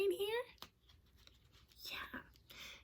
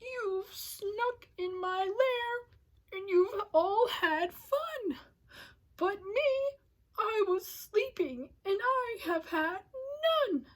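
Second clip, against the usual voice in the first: whimpering bear; 6 semitones higher than usual. Acted. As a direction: whine like a left-out bear about to cry. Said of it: "he whimpers and he moans".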